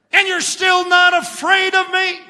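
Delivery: high, loud devil's roar. Acted. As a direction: roar it as Satan. Satan in joke.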